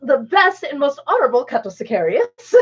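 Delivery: high pompous declaration through laughter; indignant grand boast. Retold or imitated. Wife voicing the indignant succubus, pompous and laughing. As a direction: puff up and proclaim, laughing. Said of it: "goes on this whole".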